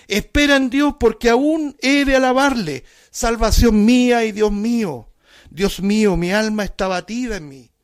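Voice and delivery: high, loud impassioned psalm reading; fervent preaching. Acted. Preacher to congregation, crying out the psalm in fervent preaching. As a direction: proclaim the psalm with rising fervor. Psalm read at full force.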